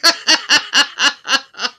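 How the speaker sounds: high loud laughter; laughing hard. Real, not acted. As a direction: crack up. Laughs at rhyme joke.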